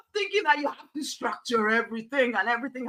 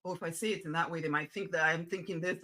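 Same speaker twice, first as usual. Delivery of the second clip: mock overthinking inner voice; 6 semitones lower, 6 dB quieter, faster than usual. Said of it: "you overthink it".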